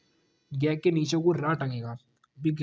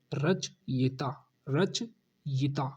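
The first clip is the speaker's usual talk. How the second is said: रचयिता drawn out slowly, each syllable held; slow model word; 3 semitones lower, 4 dB quieter, much slower than usual. Shown as a demonstration. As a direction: spell out रचयिता in slow motion. (रचयिता बहुत धीरे-धीरे)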